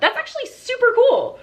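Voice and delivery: high, impressed gush; excited. Real, not acted. Vlogger impressed by bug repellent, high excited gush. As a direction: gush with pleased surprise, pitch way up.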